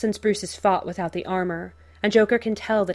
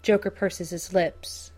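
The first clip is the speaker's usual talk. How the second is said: plain reading voice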